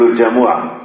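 الجمعة as "al-jamu'a", a in the first syllable; ja-mu-'a for الجمعة, as in everyday speech; colloquial mispronunciation. Shown as a demonstration. Teacher quoting what we commonly say, الجمعة as jamu'a. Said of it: "هذه لا أصل لها في اللغة".

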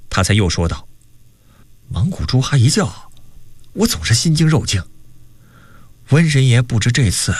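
animated storyteller with acted dialogue; story narration. A narrator's reading. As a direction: narrate the tale with wide swings in pitch. Narrates a novel chapter. (绘声绘色地讲小说)

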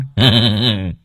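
short mocking laugh; teasing laugh. A narrator's reading. Son laughing while fleeing dad. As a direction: laugh at him as you run. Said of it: "cười hình hạch trêu tức".

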